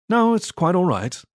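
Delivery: plain reading voice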